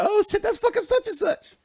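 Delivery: high-pitched "oh shit" gossip squeal; mock excitement. Online fans reacting to an article, mock excitement. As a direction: imitate a thrilled commenter, voice way up.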